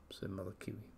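hushed whisper. A narrator's reading. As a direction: breathe it softly. Whispered narration.

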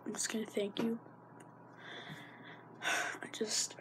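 soft quiet whisper. Real, not acted. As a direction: trail off softly.

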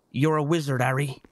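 South West English film-quote demo; model British accent. Shown as a demonstration. Accent lesson for the teasers, West Country accent. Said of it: "say it like this".

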